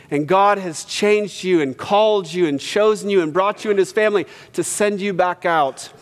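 ordinary delivery

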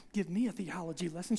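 muttered mock repeat. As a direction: mimic him while moving things. [name] mutters mockingly alone.

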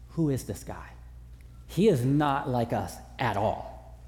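hushed wonder at Jesus; awed hush. Retold or imitated. Disciples marvel at Jesus after the storm, hushed. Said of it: "their first thought was".

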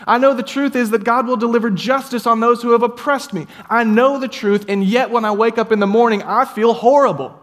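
high, loud insistent declaration; psalmist preaching to himself. Acted. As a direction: hammer each I know. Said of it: "He's like".

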